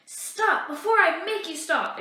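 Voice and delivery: high loud threatening shout; angry exclaim. Acted. Jean-Baptiste threatening the surveyors, loud angry shout. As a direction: warn them off with a furious shout. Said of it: "exclaims Jean-Baptiste".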